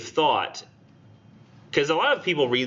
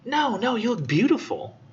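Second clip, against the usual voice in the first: high, eager reassurance; 10 semitones higher than usual. Pretend. Husband quickly reassures his wife about her dress, high and eager. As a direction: gush that she looks beautiful.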